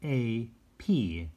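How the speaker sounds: very slow, letter by letter; drawn-out spelling; spelled slowly. Shown as a demonstration. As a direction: space out each letter. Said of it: "those dashes again".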